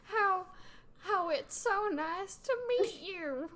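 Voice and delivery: high, slow grandma voice; Dickerney. Acted. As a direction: draw it out in a high character voice. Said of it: "you used Dickerney's voice".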